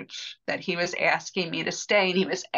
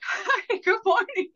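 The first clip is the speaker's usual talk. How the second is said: high, bright-voiced chirpy hello; mock cheery greeting. Priest cheerfully greeting a sobbing visitor, high mock chirp. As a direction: imitate the priest's cheerful greeting, much higher.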